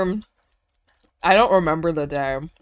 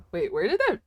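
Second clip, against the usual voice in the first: normal talking voice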